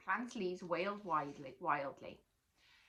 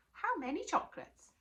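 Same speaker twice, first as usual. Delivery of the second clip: high, louder and eager; greedy boy perking up; eager question; 11 semitones higher, 4 dB louder than usual. Acted. Boy asking Natalie about chocolates, high and eager.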